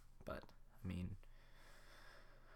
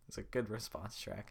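ordinary talk

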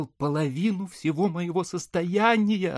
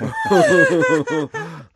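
high tender laugh of delight; proud laugh; 5 dB louder than usual. Acted. Manilov laughing high with pride at his son.

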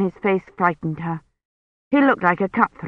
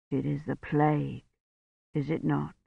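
low, very soft priest; grave, weak; 4 semitones lower, 11 dB quieter than usual. A narrator's reading. Sick priest to Kivrin from his bed, low hushed question. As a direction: ask it faintly, pitched low.

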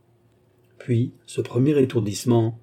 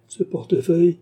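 usual speaking voice

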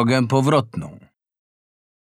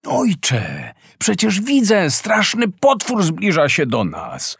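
much higher panicked cry; frightened shout; 7 semitones higher, slower than usual. A narrator's reading. Halvor pleading with his doubting father, terrified. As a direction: insist in terror that the monster is coming. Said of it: "krzyczał przerażony Halvor".

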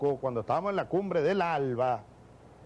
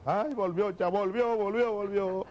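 lightly mimed wailing lament; mock crying; 3 semitones higher than usual. Mocking Santos crying at Chávez's return, half-hearted wail. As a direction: whimper it like a rival in fake despair. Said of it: "Al otro día estaba llorando".

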